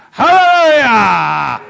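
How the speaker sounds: loud, drawn-out hallelujah; loud hallelujah cry; joyful shout. Real, not acted. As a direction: cry it out in triumph. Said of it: "Let's say it together".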